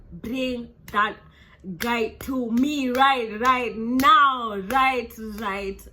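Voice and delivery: loud, slow, insistent order; government demanding. Retold or imitated. Government ordering the bounty hunters, loud insistent demand. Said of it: "They're like".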